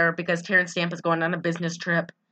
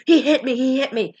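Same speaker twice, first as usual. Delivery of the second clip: mock distressed cry; 6 semitones higher, 4 dB louder than usual. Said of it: "she's like".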